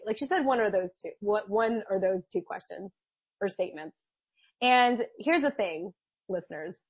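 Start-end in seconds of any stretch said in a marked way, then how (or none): none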